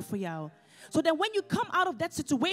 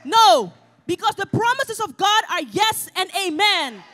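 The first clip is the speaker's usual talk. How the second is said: shouted, high preaching declaration; full-force preaching; 5 semitones higher, 12 dB louder than usual. Acted. Preacher shouting victory over the congregation, full force. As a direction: proclaim it loud over the church.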